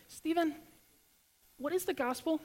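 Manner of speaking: imitating a Pakistani girl, very high and small; little Pakistani voice. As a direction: ask it in a badly imitated, high-pitched Pakistani accent. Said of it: "in her little Pakistani voice".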